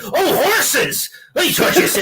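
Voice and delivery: confused pirate voice. Acted. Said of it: "a pirate coming in who misunderstood".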